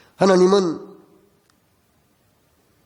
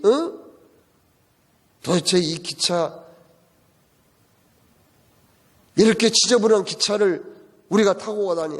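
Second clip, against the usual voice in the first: mock whiny griping, rising; imitating irritated complaining; 4 semitones higher than usual. Wife grumbling about the dirty cramped train, mock irritated whine. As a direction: gripe at everything like a fussy passenger. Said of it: "짜증을 내면서 불평을".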